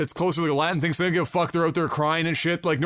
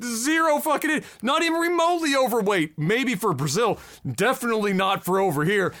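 incredulous; 7 semitones higher than usual. Retold or imitated. Incredulous retort to woman on screen.

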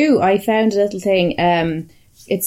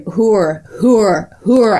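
louder, building roar of one word; 3 semitones higher, 6 dB louder, much slower than usual. Retold or imitated. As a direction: bellow it out, getting louder each time. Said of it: "as he's roaring".

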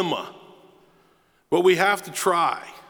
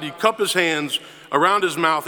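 usual speaking voice

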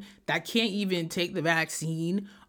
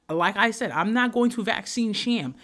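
ordinary talk, nothing marked

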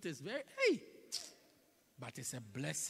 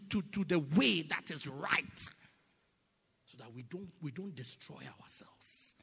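normal talking voice

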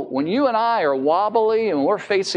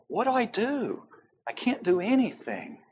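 helpless; 4 semitones higher, 6 dB quieter than usual. Retold or imitated. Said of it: "don't you feel helpless?".

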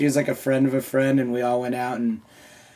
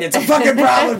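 high-pitched pretend rage; mock angry outburst; 9 semitones higher, 4 dB louder than usual. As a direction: blow up in hammed-up defensiveness, much higher. Mock outburst over gaming addiction.

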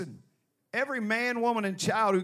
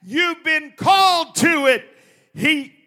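loud sharp voice; 6 semitones higher, 8 dB louder than usual. Real, not acted. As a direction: shout it out like a fired-up preacher. Preaching climax, shouting.

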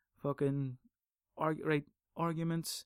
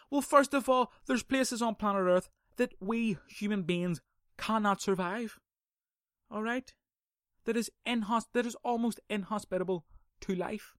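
heated rebuttal; 6 semitones higher than usual. Real, not acted. Ranting back at religious arguers, exasperated and raised. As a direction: argue it down with real heat. Heated rant against believers.

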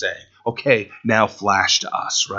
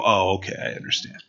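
mock cop; 5 semitones lower, 9 dB quieter, faster than usual. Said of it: "Cop's gonna go".

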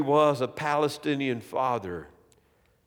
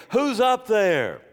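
king calls up high and raised toward the roof; a slow, raised call into the dark; shouted; 7 semitones higher, 4 dB louder, slower than usual. A narrator's reading. King woken at night calls up to the roof, raised shout. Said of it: "he shouted out".

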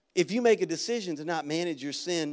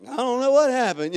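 higher, louder protest of ignorance; feigned innocence; 7 semitones higher, 7 dB louder than usual. Retold or imitated. Pastor asked about last night's dumpster fire, feigning innocence. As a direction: overplay not knowing a thing.

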